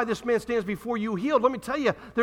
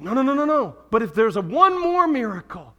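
louder, higher desperate plea from Hades; rich man's plea; 3 semitones higher, 5 dB louder than usual. Retold or imitated. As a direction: beg as the tormented rich man. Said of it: "He says".

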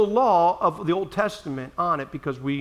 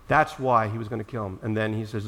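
ordinary talk, nothing marked

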